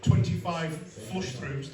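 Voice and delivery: reading it out very slowly, word by word; drawn out for effect; slow and deliberate. Retold or imitated. Reading the aftercare sheet to the crowd, slowly and deliberately. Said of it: "they called it".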